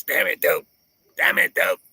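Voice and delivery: mock yelling at the dogs, voice put on; hammed-up owner scolding dogs; imitating a yell at dogs. Streamer mocks a rival yelling at her dogs, put-on voice. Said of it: "probably fucking yelling at her dogs".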